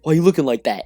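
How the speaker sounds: loud, high mock confrontation; acting tough. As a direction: challenge them, putting on toughness. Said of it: "trying to act tough".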